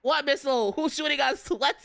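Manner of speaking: high, bewildered outburst; playful alarm. Real, not acted. Reacting to chat's missile talk, playful alarm. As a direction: fire out high, incredulous questions.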